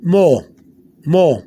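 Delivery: short, falling mo, the O's run together; two fast O's. Shown as a demonstration. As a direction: say mo with two quick blended O's. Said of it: "you're making two s-, fast O's".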